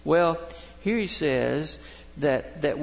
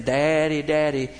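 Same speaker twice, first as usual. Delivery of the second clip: raised-voice call for dad's attention; calling child; 6 dB louder than usual. Retold or imitated. Little girl knocking on dad's bathroom door, calling out.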